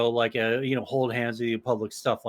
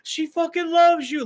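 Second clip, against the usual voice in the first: very high, teasing crowd holler; hammed-up, high-pitched jeering whoop; mock hooting and hollering; 13 semitones higher, 4 dB louder than usual. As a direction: imitate classmates whooping in falsetto. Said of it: "obligatory hooping and hollering".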